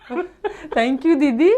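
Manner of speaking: high, sweet thank-you; butter her up politely; mock sweet reply.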